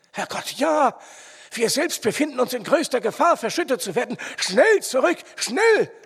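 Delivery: high, loud cries of panic, building; urgent panicked warning, rising to a yell; alarmed shouting. A narrator's reading. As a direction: shout them back out of danger. Sudden danger of cave-in.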